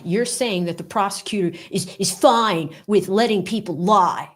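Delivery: whiny cartoonish protest, climbing high; mock indignation rising to a high wail; mocking Lewin Lanyard. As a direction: protest like an outraged cartoon kid. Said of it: "This is just what he reminds me of".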